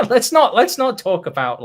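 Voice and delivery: laughing through the words; chuckling protest. Real, not acted. Laughing at Punic War joke.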